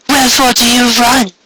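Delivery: imperious queen, contemptuous; demanding. Acted. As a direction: demand an answer of the cowering stallion. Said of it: "she demanded".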